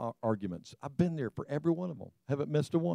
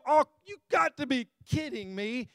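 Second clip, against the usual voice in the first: livid exasperation; 7 semitones higher than usual. Retold or imitated. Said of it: "I'm, like, livid".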